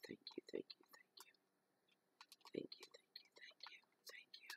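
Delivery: soft whispered thanks; whispering. Real, not acted. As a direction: breathe the thanks, barely voiced. Murmured thanks during smudge ritual.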